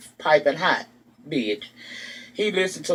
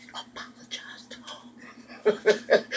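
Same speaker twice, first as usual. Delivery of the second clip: mock whisper; 6 semitones higher, 7 dB quieter, much slower than usual. [name] urging from the back seat.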